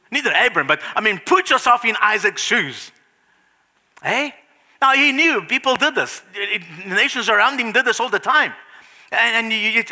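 ordinary talk, nothing marked

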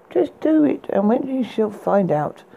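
put-on a saucy girl teasing from her tower; young maiden voice. Rapunzel teases the prince from the window, high girlish voice.

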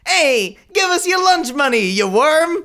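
high, pushy bully voice; movie bully. Acted. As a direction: taunt like a bully shaking down a kid. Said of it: "these bullies show up".

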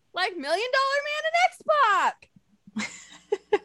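high, slow squeal of delight; giddy. Real, not acted. To co-host, a high, slow gleeful squeal. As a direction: savour each name with glee. Giddy about drinking with wrestlers.

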